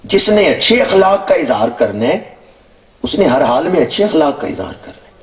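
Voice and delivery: high-pitched sermon proclamation; emphatic preaching. Real, not acted. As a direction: lift the voice high and lay down the principle.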